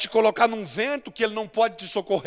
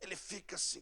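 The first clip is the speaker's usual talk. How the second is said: ordinary delivery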